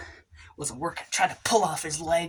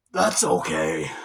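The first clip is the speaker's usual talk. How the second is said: much lower, loud, slow deep reply; deep manly voice; 11 semitones lower, 7 dB louder, slower than usual. Acted.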